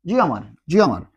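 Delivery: জিয়া মার with the double l sounded like j, jiamar. Shown as a demonstration. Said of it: "উচ্চারণ হবে ইয়া বা জিয়া".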